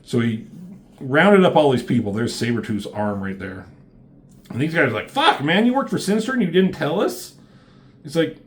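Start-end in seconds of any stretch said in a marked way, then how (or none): 5.1-7.3 high, hurried mock outrage; acted-out indignant shock; shocked disbelief. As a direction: retell it as a stunned accusation, pitched up. Teammates outraged at Gambit's secret.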